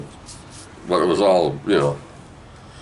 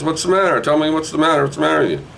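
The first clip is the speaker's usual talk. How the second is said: mom asking; 10 semitones higher, faster than usual. Retold or imitated. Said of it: "and Mom goes".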